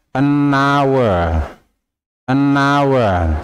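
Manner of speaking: "an hour" said "an awer", h silent. Shown as a demonstration. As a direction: link "an" straight onto "hour". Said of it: "Jadi bacanya". ("an hour" dibaca tanpa bunyi h)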